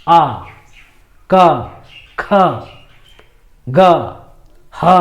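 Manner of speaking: आ, क, ख, ग, ह voiced straight from the throat, one at a time; isolated throat letters आ, क, ख, ग, ह, paused between each; throat sounds demonstrated. Shown as a demonstration. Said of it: "direct जो है कंठ से ध्वनि बाहर".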